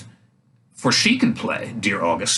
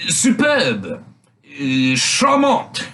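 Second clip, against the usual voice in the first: French court ladies; 2 semitones higher, much slower than usual. A narrator's reading. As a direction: coo it like a court lady showing off her French. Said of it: "said the ladies of the court".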